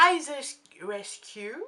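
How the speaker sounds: Eyes Rescue said in a clumsy French-English accent; self-mocking English accent on Eyes Rescue. Real, not acted. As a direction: try the English name and botch it a little. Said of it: "Super accent".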